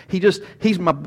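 usual speaking voice

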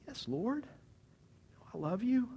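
high, soft, halting reply; wounded answer. Acted. Peter asked a second time, knife in heart, wounded. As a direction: answer haltingly, small and hurt.

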